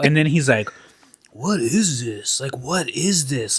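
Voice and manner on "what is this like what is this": slow, softly puzzled mock voice; hushed, slow bewilderment, acted out; quizzical. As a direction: imitate someone baffled, drawing it out quietly.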